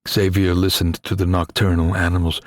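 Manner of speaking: ordinary delivery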